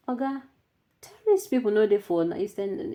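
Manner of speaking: sudden high, loud protest at the viewer; exasperated retort. Real, not acted. Host answering a viewer's claim, exasperated high retort. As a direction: throw it back at him, high and incredulous.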